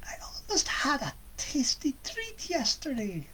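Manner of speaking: high, soft scheming witch voice. Acted. Baba Yaga musing to herself, high witch voice. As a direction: purr it like a hungry witch.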